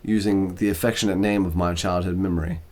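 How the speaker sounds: ordinary delivery, nothing marked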